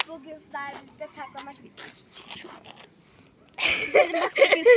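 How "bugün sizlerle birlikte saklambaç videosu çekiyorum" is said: soft secretive whisper. Real, not acted. Announcing hide and seek secretly.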